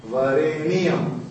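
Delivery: Varenyam stretched slowly as va-re-ni-yam; elongated Varenyam. Shown as a demonstration. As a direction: draw Varenyam out into four beats. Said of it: "you have to elongate it".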